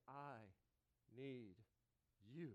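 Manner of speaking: slow, quiet, word by word; soft plea. Shown as a demonstration. Preacher modelling the simplest prayer, soft and slow. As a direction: let each word fall gently.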